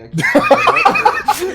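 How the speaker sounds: squealing, high laughter; cracking up so hard it squeaks; breaking up laughing. Real, not acted.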